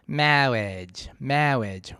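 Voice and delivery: "marriage" slow, tongue humped, tip behind bottom teeth; Peter Cook marriage. Shown as a demonstration. Voice coach shows the humped-tongue "marriage". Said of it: "give that hump tongue position a try".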